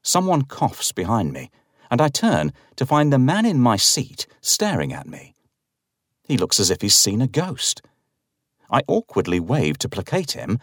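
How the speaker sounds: ordinary delivery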